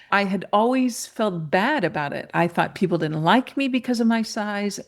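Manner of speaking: ordinary talk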